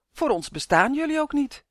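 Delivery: hurt, defiant exclamation; exclaiming. A narrator's reading. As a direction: call out in protest. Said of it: "riep hij uit".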